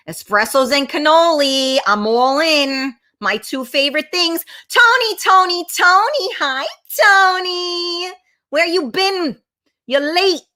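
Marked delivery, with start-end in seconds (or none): 4.7-10.4 high, excited name-calling, stretched hello; playful high-pitched welcome with a teasing scold; calling out a greeting. Real, not acted. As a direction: hail a late friend in a raised sing-out. Calling to Tony who arrives late.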